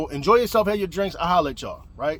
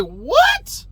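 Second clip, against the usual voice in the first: high, loud, rising disbelief; incredulous; 13 semitones higher, 4 dB louder than usual. Retold or imitated. Man told of the girl's claim, incredulous. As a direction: shoot up high in astonishment.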